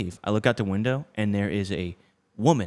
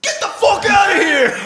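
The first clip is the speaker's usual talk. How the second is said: high, loud furious shout; angry yell; 7 dB louder than usual. Retold or imitated. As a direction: bellow him off the driveway. Yelling at the poor kid.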